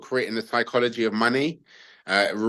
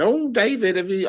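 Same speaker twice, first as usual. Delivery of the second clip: high-pitched mock South African neighbour, quickly abandoned; a failed try at a South African accent; 13 semitones higher than usual. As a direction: put on a South African accent for the nosy neighbour, much higher than your own voice. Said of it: "I can't do a South African accent".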